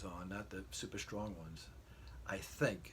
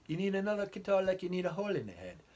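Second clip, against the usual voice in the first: fast, higher English wife retort; English accent; 10 semitones higher, 7 dB louder, faster than usual. Retold or imitated. Wife scolding about another guitar in an English accent. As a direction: snap back quickly in an English lilt. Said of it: "I'll try to use an English accent".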